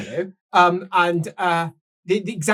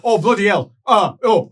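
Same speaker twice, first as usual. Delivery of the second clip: ordinary delivery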